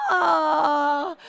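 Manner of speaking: long, loud "oh" of embarrassment; exaggerated awkward moan; mock anguished cry. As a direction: draw out a loud cringing wail. Acting out an awkward missed hug.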